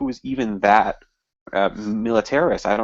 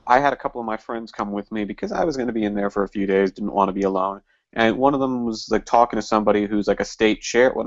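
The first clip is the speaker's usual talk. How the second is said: ordinary talk, nothing marked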